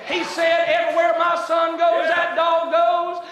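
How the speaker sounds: loud, high, driving preacher's climax; preaching at full force. Acted. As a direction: shout it out to the congregation, slowing on the big words.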